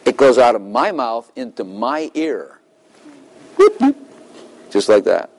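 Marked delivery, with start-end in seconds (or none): 3.6-4.0 high-pitched quick double whoop; imitated whoop. Shown as a demonstration. Preacher mimics words going from mouth to ear, whooping. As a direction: make two high swooping noises.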